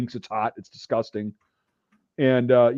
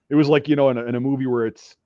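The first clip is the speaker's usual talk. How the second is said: plain reading voice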